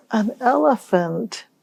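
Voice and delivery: ordinary delivery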